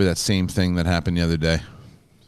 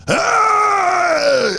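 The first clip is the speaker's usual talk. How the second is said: high, loud street yell; sudden high shout, mock maniac; scream; 5 dB louder than usual. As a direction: bellow like a New York maniac. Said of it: "he's going".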